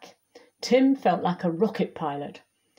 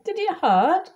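put-on higher-pitched questioning child; lightly voiced Tim; 9 semitones higher than usual. A narrator's reading. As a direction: ask it up high, as a curious child. Child character asking in story.

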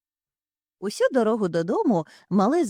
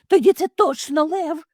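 loud, high alarmed cry; shouting; 9 semitones higher, 6 dB louder than usual. Acted. Mother hare cries out the lion warning. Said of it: "закричала мама Зайчиха".

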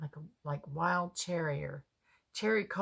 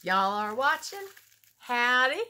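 loud, high hammed-up Southern "Howdy"; a joking, overdone Southern "Howdy", said louder and higher; mock Southern howdy; 6 semitones higher, 8 dB louder than usual. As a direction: belt out "Howdy" like a cartoon Southerner. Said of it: "They don't talk like that".

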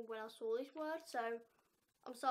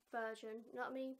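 usual speaking voice

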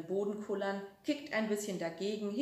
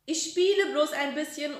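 put-on higher, louder child's call; calling back; 7 semitones higher, 7 dB louder than usual. A narrator's reading. Frieder calls back to grandma in the kitchen, loud. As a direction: shout it back to another room. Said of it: "ruft der Frieder zurück".

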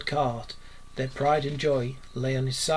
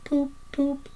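faintly murmured horn imitation; 13 semitones higher than usual. A narrator's reading. Toad entranced by the vanished motor car, faint high horn toots. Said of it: "he faintly murmured".

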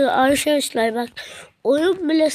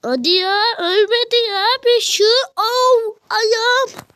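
frustrated yelp; 8 semitones higher, 5 dB louder than usual. Real, not acted. Frustrated in a game moment.

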